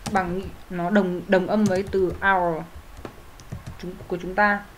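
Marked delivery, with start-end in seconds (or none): 2.2-2.6 "hour" with a silent h. Shown as a demonstration. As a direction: begin "hour" straight on the vowel. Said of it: "âm h câm".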